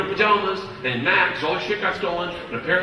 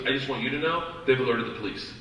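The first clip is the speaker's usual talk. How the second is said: calm and matter-of-fact; 4 semitones lower, 6 dB quieter than usual. Retold or imitated. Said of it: "real like a human being".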